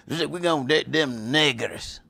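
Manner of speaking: lightly voiced outsiders; mock outsider voice. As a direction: sneer it as they would. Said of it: "All the people that just".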